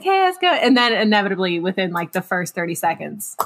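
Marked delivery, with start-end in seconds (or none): 0.0-0.5 high mom voice, much higher; a bright imitation of mom calling; man doing a woman's voice. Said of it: "a terrible mom voice whenever I'm imitating my mom".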